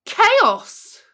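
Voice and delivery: loud, high cry of "Chaos!"; dramatic shout. A narrator's reading. Narrator bursting out as night falls at the zoo, loud dramatic cry. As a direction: burst out with it like an alarm.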